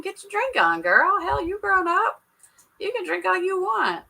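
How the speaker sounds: playful cheer. Acted. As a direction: egg her on like a party friend. Cheering a friend on to drink.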